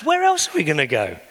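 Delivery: preacher's climax, climbing in pitch; impassioned preaching. Real, not acted. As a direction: build the fervour to a peak. Preaching climax, rising in force.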